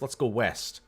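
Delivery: usual speaking voice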